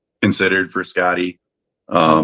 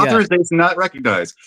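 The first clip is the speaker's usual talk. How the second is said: higher-pitched imitation of a ship's computer; lightly voiced computer refusal; 7 semitones higher, faster than usual. Ship's computer rejecting the officer's code, imitated computer voice. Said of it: "That voice was done by the late Majel Barrett".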